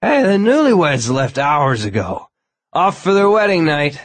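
high, loud tipsy party guest; drunk man voice. A narrator's reading. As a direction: boom it out like a happy drunk. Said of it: "The man was obviously drunk".

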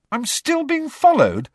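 high, loud indignant question; angry. A narrator's reading. Harry asking if he is still watched, angry. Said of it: "asked Harry angrily".

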